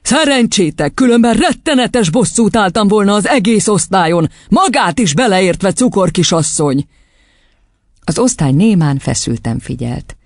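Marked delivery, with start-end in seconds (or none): 0.0-6.9 higher-pitched thundering scolding. A narrator's reading. Headmistress rebuking the class and teacher, thundering. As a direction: thunder it out as a threat. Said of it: "dörögte".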